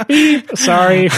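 high, slow beep through laughter; giggling apology with a beep; laughing mock beep. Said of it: "you're like".

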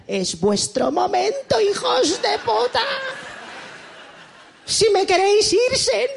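high, slow, pleading to his friends; desperate plea. Retold or imitated. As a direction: beg the friends to stop the wedding, slow and high. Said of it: "a mis amigos en plan". (novio suplicando a sus amigos, agudo y lento)

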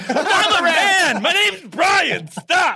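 high, loud, slow mock shout; indignant shout. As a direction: yell it like an offended giant. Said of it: "I'd be like".

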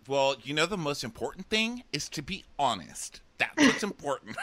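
a hint of a smug counselor; lightly mocking counselor. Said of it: "who's like".

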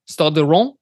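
"Stade de Reims" with a shaky French try, Reims as "Rò"; attempted French accent. Shown as a demonstration. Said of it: "the French accent is probably my worst".

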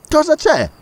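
high, sudden alarm; startled question. Acted. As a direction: ask in a jolt of surprise. Kennedy startled by jolt. (Kennedy quasi cade, sorpreso)

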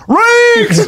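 high hollered greeting; imitated scream. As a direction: call the name out, high and stretched. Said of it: "I'm getting screamed at".

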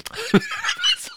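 very high squealing laugh; high-pitched helpless giggle. Real, not acted. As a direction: crack up at your own slip. Laughs at own slip.

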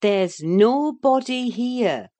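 firm, loud, drawn-out denial; emphatic nurse putting her foot down; insistent. A narrator's reading. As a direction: insist slowly, pitch lifted. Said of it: "I insisted".

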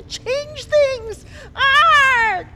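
high, loud raging scream; furious scream. Acted. Queen screams in rage at the girl. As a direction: explode into a furious cry.

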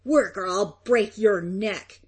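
slow, rough-edged menacing farmer; savage threat. A narrator's reading. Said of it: "the farmer whispering savagely".

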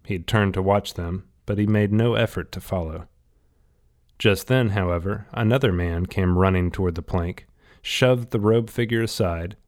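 ordinary delivery

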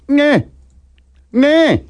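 lightly mimicked deaf kid; imitating kid. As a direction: copy the kid's strained sound. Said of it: "the kid's like".

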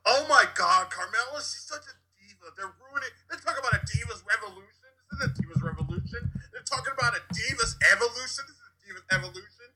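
high-pitched outraged fan impression; imitating ranting friend. Podcaster quotes friend Chris's wrestling complaint, high mock rant. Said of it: "my friend Chris can go".

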